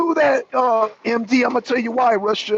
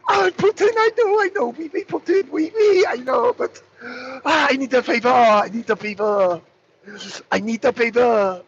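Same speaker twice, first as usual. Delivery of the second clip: pleading Macron. Retold or imitated. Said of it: "I'm just reenacting the phone call".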